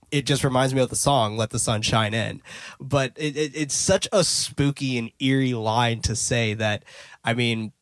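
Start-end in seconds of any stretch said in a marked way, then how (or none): none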